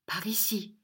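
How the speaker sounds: hushed direction. A narrator's reading. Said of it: "dit-elle".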